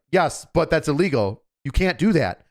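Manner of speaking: insistent German. Retold or imitated. German insists in retold quarrel.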